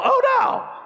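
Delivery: squeaked way up high in mock horror; a dad who just heard the worst good news; hammed-up falsetto oh no; mock dismay. Son got the lead role.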